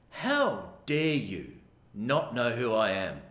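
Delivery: very slow, measured mock outrage, sinking low at the end; simmering indignation. Voicing the offended ex-president's thoughts, slow contained fury. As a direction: space it out like imagined boiling anger. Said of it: "his blood started to boil".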